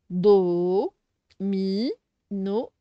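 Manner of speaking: "Do, mi, no" in separate, low beats; teacher sounding out "Do, mi, no"; syllable by syllable. Shown as a demonstration. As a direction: hold "Do" and "mi", then a short "no". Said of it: "on fait toujours les syllabes".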